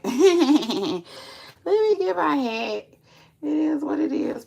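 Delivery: high laughing repeat. Real, not acted. Laughing repeat to viewers, high and loud.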